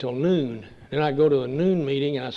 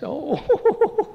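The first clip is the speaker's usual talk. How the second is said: mock surprised oh; 6 dB louder than usual. German listener reacting to Dallas.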